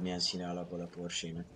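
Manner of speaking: ordinary talk, nothing marked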